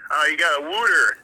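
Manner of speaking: "wooter" with an oo sound, Philly style; Philly "wooter" for water. Retold or imitated. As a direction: say "wooter" rounding the vowel like Philly. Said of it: "not water".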